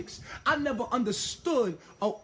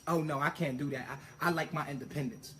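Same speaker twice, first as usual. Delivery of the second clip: lower, softer mock of his baby mama's voice; imitating baby mama; 9 semitones lower, 7 dB quieter than usual. Man mocking his baby mama's refusal, lower and softer. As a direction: play her as dismissive and a bit precious, lower and softer. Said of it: "This is what my baby mama told me".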